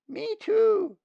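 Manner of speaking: very high, loud squeaky chick voice; eager nestling. Acted. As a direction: squeal it out so you are not forgotten. Nestlings begging to be fed.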